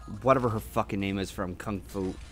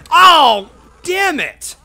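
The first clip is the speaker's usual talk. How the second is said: frustrated yell; 13 dB louder, much slower than usual. Real, not acted. Hit by barrel in game.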